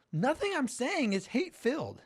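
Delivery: high, calm imitation of Shapiro; lightly voiced pundit defending himself; Ben Shapiro quote. Retelling Shapiro's words on panel.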